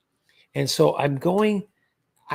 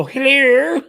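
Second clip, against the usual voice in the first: a high, loud rising "Hello." copying the caller; mocking the scammer back; 8 semitones higher, 7 dB louder than usual. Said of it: "I go".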